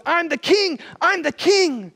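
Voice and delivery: high desperate yell of I'm the king; a king shouting his rank in panic; yelling at the top of his lungs. Retold or imitated. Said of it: "yells out at the top of his lungs".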